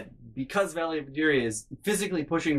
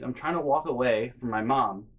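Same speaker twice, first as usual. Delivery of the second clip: ordinary delivery, nothing marked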